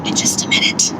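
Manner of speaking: breathy whispered sign-off. Real, not acted. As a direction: drop to a whisper.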